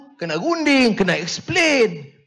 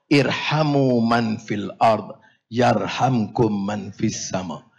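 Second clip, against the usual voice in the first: hadith recitation; 9 semitones lower, slower than usual. Real, not acted.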